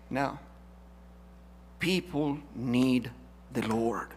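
ordinary talk

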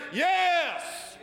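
a high, loud, sure yes; eager yes. Shown as a demonstration. Pastor shows the church how to answer, high and loud. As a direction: shout it out, no doubt. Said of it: "Y'all should say".

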